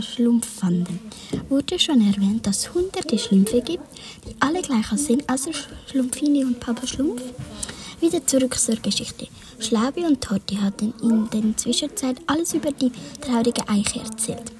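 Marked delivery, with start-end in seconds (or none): none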